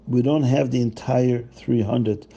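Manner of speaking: plain reading voice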